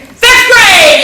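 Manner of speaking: high, loud burst of delight; excited exclaim. Real, not acted. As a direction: shout it out thrilled. Excited repeat.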